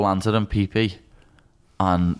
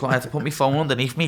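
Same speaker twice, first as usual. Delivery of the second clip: ordinary talk, nothing marked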